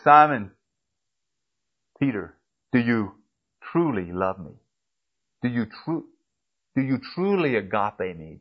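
Jesus tenderly asking Peter, soft and searching. Acted. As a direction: ask it gently, as Jesus to Peter. Preacher voices Jesus asking Peter.

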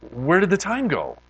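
high, incredulous mock disbelief; high-pitched retold amazement; astonished question. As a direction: act out a friend shocked at the time. Retelling friend's astonishment at lost hours.

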